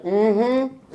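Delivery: doubtful hum of half-hearted agreement; unconvinced wife's tone. Retold or imitated. Wife not chiming in on your dream, skeptical hum. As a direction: hum it without any cheerleading, imitating the wife. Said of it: "you get that tone like".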